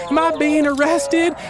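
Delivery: high-pitched incredulous question; bewildered arrestee. Acted. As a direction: ask it in alarmed disbelief. Arrested man asks officers.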